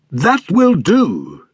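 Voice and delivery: put-on high, very slow, delighted older man voice; jovial stout man. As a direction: savour it like a pleased fat gentleman.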